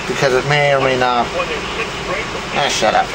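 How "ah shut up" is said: ordinary talk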